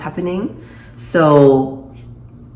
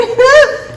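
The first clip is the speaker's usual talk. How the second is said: loud, high laugh; shrieking laugh; high burst of laughter; 7 dB louder than usual. Real, not acted. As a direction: crack up hard. Laughing at the firing scene.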